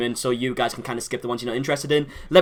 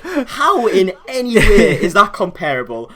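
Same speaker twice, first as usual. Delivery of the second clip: high, loud, drawn-out retold disbelief; incredulous protest; 6 semitones higher, 7 dB louder, slower than usual. Host retelling his reply to an unfair comparison, incredulous. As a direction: stretch it out in laughing indignation.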